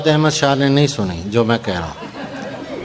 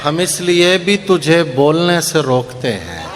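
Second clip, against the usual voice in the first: slow, measured mushaira recitation; poetry recitation; 5 semitones higher, slower than usual. Acted. Poet reciting ghazal to a mushaira audience, measured declamation. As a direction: recite the couplets, drawing out key words.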